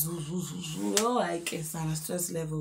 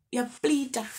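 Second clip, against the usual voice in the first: scoffing; 6 semitones higher, 5 dB louder than usual. Real, not acted. Mocking boyfriend again.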